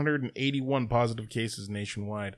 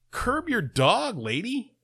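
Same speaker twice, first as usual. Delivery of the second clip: mock scolding; 9 semitones higher, slower than usual. Mock scolding the dog walker.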